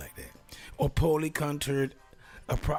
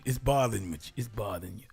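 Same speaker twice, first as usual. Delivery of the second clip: ordinary talk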